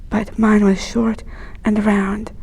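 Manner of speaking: usual speaking voice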